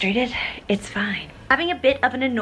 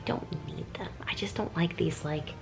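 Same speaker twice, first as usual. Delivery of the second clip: hushed aside whisper; 3 semitones lower, 13 dB quieter than usual. Real, not acted. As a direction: whisper it like a secret. Whispered aside about garlands.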